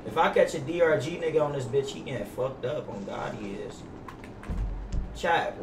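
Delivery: normal talking voice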